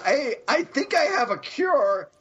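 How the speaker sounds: zombie Pym offer. Retold or imitated. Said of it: "zombie Pym says".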